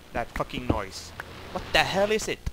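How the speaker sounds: ordinary talk, nothing marked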